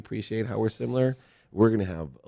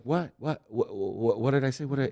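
mock-flustered, higher, stammering repeats; nervous; 8 semitones higher than usual. Father caught out by his kids, imitated nervous stammer. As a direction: imitate yourself panicking and stumbling over the question. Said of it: "I got totally nervous".

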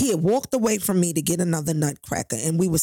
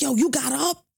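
put-on angry boyfriend; 10 semitones higher than usual.

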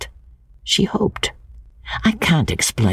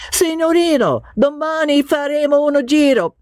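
higher-pitched Italian cabman voice; an Italian driver calling out, higher. Acted. Cabman calling to George, higher Italian voice.

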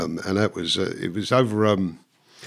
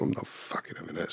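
muttered inner thought; 5 semitones lower, 13 dB quieter than usual. Retold or imitated. Angry inner thought at litterer.

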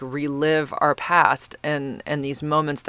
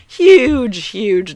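excited exclamation; 7 semitones higher, 9 dB louder than usual. Real, not acted. Marvelling at fast upload.

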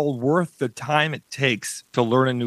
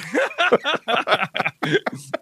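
gleeful laugh; 7 semitones higher than usual. Real, not acted. Laughs at proving people wrong.

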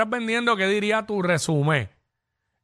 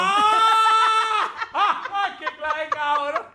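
high shriek into laughter; shocked shout; 11 semitones higher, much slower than usual. Real, not acted. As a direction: yelp in disbelief, then laugh.

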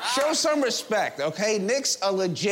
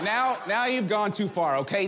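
ordinary delivery

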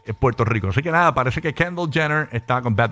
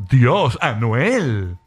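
en su flow; 4 semitones lower, much slower than usual. Acted. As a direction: stretch both words out in the commenter's flow. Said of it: "Lo dije en su flow". (imitando su flow, palabras bien alargadas)

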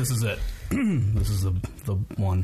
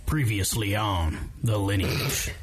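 slow, dramatic recap read; trailer narrator voice; 3 semitones lower than usual. Acted. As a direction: announce it like a TV recap. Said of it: "I'm trying to get into character".

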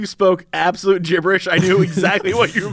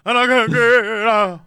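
slow crooning [name] voice; a hint of [name] crooning; [name] impression; 3 semitones higher, slower than usual. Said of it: "we gotta get you doing, like, [name]".

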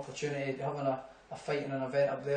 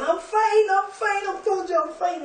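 high, loud, furious insisting; a man yelling he's fine at medics; raging protest; 6 dB louder than usual. Retold or imitated. The tubed-up friend shouting at the medics that he's fine. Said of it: "sitting there raging".

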